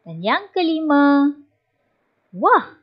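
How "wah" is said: higher amazed wah. Shown as a demonstration. Teacher showing wonder with wah. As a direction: marvel at it. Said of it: "Menyatakan perasaan hairan".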